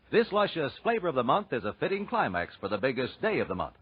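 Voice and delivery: ordinary talk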